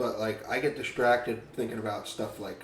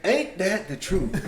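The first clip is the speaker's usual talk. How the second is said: put-on slow folksy old-timer drawl; old grandpa voice; 6 semitones higher, 4 dB louder, slower than usual. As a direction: mimic a grandpa, drawn out. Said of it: "were you raised by your grandparents".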